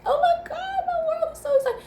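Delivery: put-on very high squeaky excited child; high childlike wonder; toddler voice. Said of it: "They're like".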